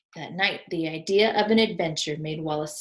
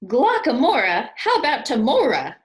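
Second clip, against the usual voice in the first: higher, louder delighted exclaim; cried out; 6 semitones higher, 10 dB louder than usual. Acted. Albert reacting to Wallace's list, high and loud. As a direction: call the names out with glee. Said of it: "cried Albert".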